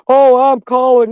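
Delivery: high, whiny mocking voice; mocking a whiny snitch who threatens to call. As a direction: sneer it like a tattletale. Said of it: "And he's going".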